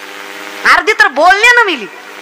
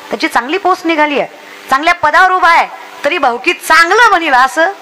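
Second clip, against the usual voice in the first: ordinary talk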